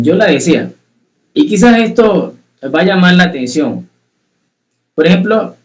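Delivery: ordinary talk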